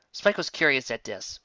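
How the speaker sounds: usual speaking voice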